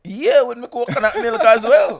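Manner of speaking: loud, high, laughing reply. Real, not acted. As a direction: crack up while joking along. Laughing joke about cooking curry.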